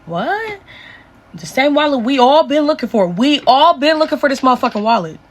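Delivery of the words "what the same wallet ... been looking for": high, sharp disbelieving what; shocked what. Retold or imitated. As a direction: squeak it out in total disbelief. Cousin reacts on the phone.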